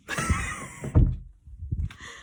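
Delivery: breathless high squeal of a laugh. Real, not acted. Relieved after the cat leaves, airy squeal laugh. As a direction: let out an airy shrieking giggle.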